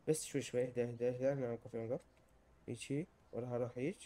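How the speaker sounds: low, hushed self-coaching; soft and low, concentrating; careful muttering. Real, not acted. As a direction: talk yourself through a delicate move. Carefully edging along in game.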